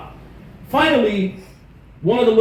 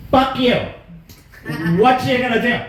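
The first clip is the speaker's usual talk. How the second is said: defiant, raised yell; retold angry yell; 5 semitones higher than usual. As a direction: snap back at the mother.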